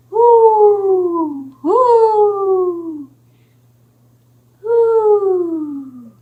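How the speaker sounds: high hooting owl call. Acted. Storyteller voicing the owl for children, high hoots. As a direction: hoot like an owl in the night. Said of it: "It says".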